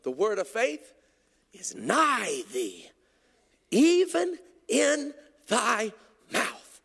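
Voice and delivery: slow, drawn-out proclamation with soaring peaks; declaimed scripture. Real, not acted. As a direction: stretch each word with preacher's fervor.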